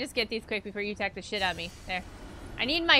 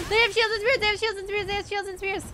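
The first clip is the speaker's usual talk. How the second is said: panicked; 9 semitones higher, 3 dB louder than usual. Real, not acted. Panic at armed enemies.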